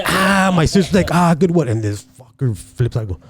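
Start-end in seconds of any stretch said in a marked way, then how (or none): 0.1-1.7 mock crowd reaction. Comic retells how the crowd reacted, mock high cry. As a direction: imitate an audience reacting to a zinger.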